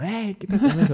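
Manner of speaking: high-pitched pretend yell, kept at normal volume; hammed-up road shout, held back; mock driver's yell. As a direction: imitate yelling at another driver without real volume. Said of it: "no voy gritando".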